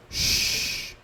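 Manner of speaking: "X" as a long hissed sh; sh sound. Shown as a demonstration. Teacher demonstrates the sibilant X sound to students. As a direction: sustain the "X" as a voiceless sh. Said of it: "com o som de X".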